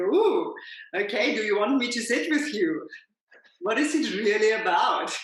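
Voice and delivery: high, loud, gently coaxing voice of a lampshade. Retold or imitated. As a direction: coo at the upset person like a kindly lampshade. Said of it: "They go".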